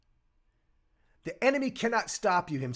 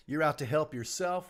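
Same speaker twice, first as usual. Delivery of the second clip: ordinary delivery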